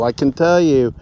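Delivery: ordinary talk, nothing marked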